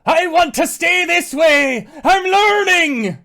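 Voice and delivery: high, full-force wail of protest; protesting shout. Acted. Elk refusing to be changed back, protesting shouted wail. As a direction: holler it, stretching the last word out.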